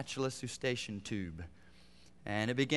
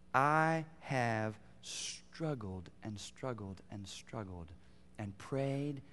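slow, much softer words; heavy confession; 4 semitones lower, 7 dB quieter, much slower than usual. Real, not acted. Preacher admitting his long struggle, slow and soft. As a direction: let it sink low and slow.

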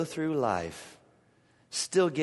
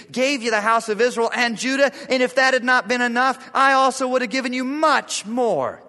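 loud, higher proclamation; preaching at full force; 7 semitones higher, 8 dB louder, faster than usual. Acted. God rebuking David through Nathan, loud and high. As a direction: thunder God's word at the king. Voicing God's words loudly.

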